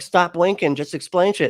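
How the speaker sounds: mock exasperated demand; exasperated retort. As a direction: act out losing patience with unhelpful people. Acting out exasperation at unhelpful posters.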